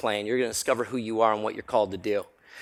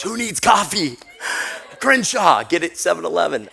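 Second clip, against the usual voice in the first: high, loud excited cry; excited exclamation; 5 semitones higher, 3 dB louder than usual. Retold or imitated. Speaker reliving the frantic drive, high and loud. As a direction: throw it out with a rush of adrenaline.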